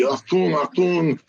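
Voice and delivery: lightly imitated German accent; a hint of a stern German scientist accent; mock German accent, barked lightly. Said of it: "the doctor spoke in his German voice".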